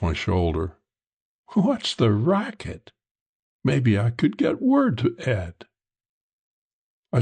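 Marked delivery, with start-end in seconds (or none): 1.5-5.7 slow, higher woman's character voice; cuddling woman, coaxing. Acted. Woman cuddled against his shoulder, slow coaxing. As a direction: coax it out slowly, pitched up.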